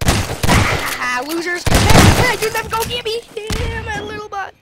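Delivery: high, loud teasing calls; gloating taunts. Real, not acted. Gamer taunting the other players from his box. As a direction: rub it in.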